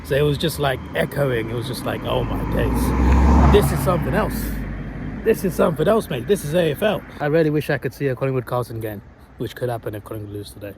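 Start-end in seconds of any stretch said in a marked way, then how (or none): 2.0-7.0 loud, high-pitched amazement; emphatic, raised-voice footy awe; retold awestruck excitement. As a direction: relive being blown away by the crowd. Retelling awe amid chanting fans.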